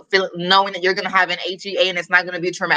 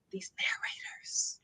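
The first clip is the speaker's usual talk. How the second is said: breathy whisper, low and conspiratorial; whispered aside; 20 dB quieter than usual. Real, not acted. Whispering a complaint about narrators to the listeners. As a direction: drop to a whisper for the gripe.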